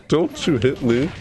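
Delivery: ordinary talk, nothing marked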